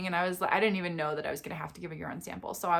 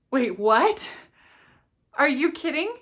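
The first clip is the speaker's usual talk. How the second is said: louder, rising wait what; stunned disbelief; 5 semitones higher, 5 dB louder than usual. Real, not acted. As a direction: caught totally off guard. Second heartbeat revealed.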